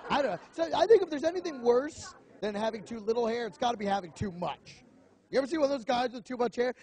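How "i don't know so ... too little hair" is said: ordinary talk, nothing marked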